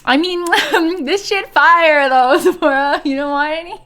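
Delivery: high, loud talk broken by laughs; amused laughing. Real, not acted. Streamer jokes with her cat, laughing high and loud. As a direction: giggle through a teasing offer.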